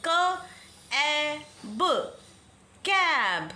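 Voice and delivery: slow sounding out, then the blended word; letters sounded one by one, then कैब whole; phonics blending. Shown as a demonstration. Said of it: "अब ये क्या है?".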